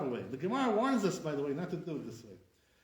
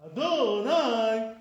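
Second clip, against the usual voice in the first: "Adonai" very loud and slow, a-DOO-nai; mimicked "Adonai" with misplaced, stretched stress on DO; wrong-syllable Adonai; 4 semitones higher, 8 dB louder, much slower than usual. Shown as a demonstration. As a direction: belt out the DO of "Adonai" and hold it.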